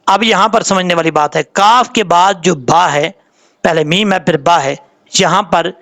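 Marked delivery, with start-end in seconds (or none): none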